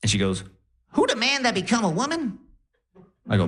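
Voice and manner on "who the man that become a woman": high, put-on Def Jam comedian voice; crazy Cat Williams accent. Grandma on morphine greets grandson in a high comic accent. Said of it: "talking in a crazy accent".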